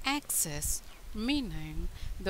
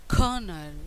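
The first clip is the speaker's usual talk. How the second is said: "colonel" as KER-nul, first syllable louder; stress on first syllable; 6 dB louder than usual. Shown as a demonstration. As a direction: land hard on the start of "colonel". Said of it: "The stress on the first syllable".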